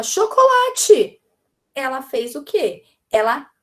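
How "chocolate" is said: "Chocolate" whole, high and loud; child answering teacher. Acted. Child answers what word was said, high loud call. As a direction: call out "Chocolate" like a pupil who got it. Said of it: "E ela falar".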